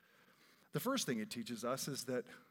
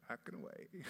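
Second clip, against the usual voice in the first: sheepish retreat; 9 dB quieter than usual. Retold or imitated. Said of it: "He's like".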